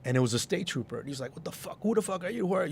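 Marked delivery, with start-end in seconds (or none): 1.4-2.7 retold cursing. State trooper challenging the promoter outside the club, retold cursing. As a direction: imitate an irate challenge without raising the volume. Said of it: "He's all cursing at me".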